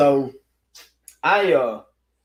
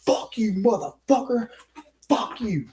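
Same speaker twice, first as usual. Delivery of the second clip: higher mock cursing; imitating angry man; 8 semitones higher than usual. The angry stranger cursing at the narrator. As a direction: hurl the curses at him.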